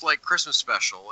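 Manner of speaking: ordinary talk, nothing marked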